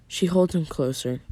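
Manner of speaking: usual speaking voice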